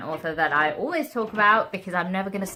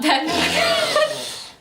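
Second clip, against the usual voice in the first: burst of laughter. Real, not acted. Burst of laughter mid-take.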